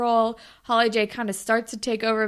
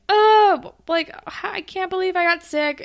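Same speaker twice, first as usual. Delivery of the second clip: higher, whining mock despair; mock sick dismay; 8 semitones higher, 4 dB louder than usual. Retelling Shantae groaning that she got sick. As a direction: play up her despair at getting sick. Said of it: "she's like".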